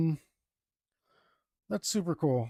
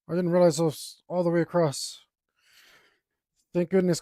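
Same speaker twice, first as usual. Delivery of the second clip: ordinary delivery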